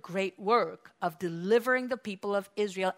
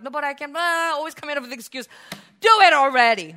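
higher, louder fed-up parent; frustrated parent voice, loud at the end; exasperated parent; 5 semitones higher, 9 dB louder, faster than usual. Retold or imitated. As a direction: scold a kid who keeps making excuses. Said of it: "you tell your kid to do something".

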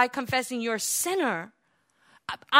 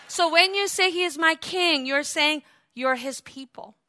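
usual speaking voice

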